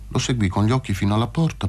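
ordinary delivery

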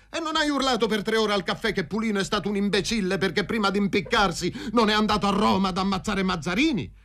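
high-pitched indignant rush; heated accusation. A narrator's reading. Fazio accusing Paroni of coffee-house boasting, heated. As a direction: throw it at him, pitched high throughout.

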